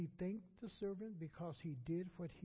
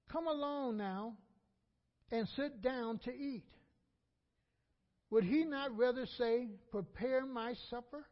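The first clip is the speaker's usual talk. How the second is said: loud, raised sermon reading of the parable; preaching at full force; 4 semitones higher, 6 dB louder than usual. Acted. Preacher reads the servant parable to the congregation, loudly. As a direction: proclaim it to the back pew.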